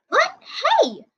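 high, sudden indignant what; startled outcry. Acted. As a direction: yelp as the line goes dead. Phone cut off.

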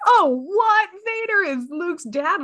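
mock amazement at the twist; shocked viewer. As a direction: cinema viewer stunned to learn Luke's father, astonished. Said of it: "then they're like".

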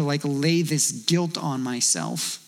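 normal talking voice

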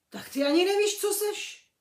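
astonished. A narrator's reading. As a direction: Hagrid can't believe it. Hagrid asks in amazement.

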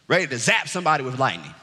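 ordinary delivery

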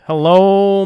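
loud, spoken Hello, the first take. Pretend. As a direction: call out Hello, to the week.

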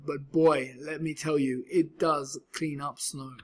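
plain reading voice

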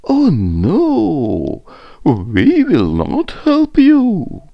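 high, loud, drawn-out refusal; lofty high animal voice; giraffe voice. A narrator's reading. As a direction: play a giraffe refusing in singsong. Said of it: "Giraffe said".

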